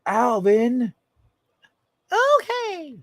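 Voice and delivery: high squeaky chipmunk calling Alvin; high cartoon chipmunk voice. Shown as a demonstration. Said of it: "It'll make more sense like this".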